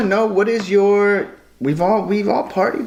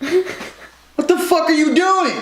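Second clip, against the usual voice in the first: much higher, slow, laughing mock shock; shocked brother imitation; 12 semitones higher, much slower than usual. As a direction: play the stunned brother, drawn out and high. Brother catches him peeing.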